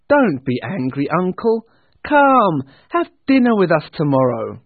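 loud, high, eager invitation; pleading nephew. Acted. Nephew inviting his uncle to dinner, pleading. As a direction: urge him with lifted, hearty voice.

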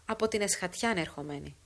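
usual speaking voice

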